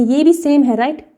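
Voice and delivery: usual speaking voice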